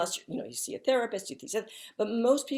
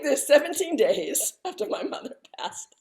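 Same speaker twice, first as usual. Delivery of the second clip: indignant retort; 6 semitones higher, 6 dB louder than usual. Retold or imitated. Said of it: "I was like".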